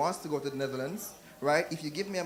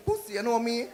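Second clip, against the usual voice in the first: Jamaican patois; 7 semitones higher than usual. Retold or imitated. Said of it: "for example".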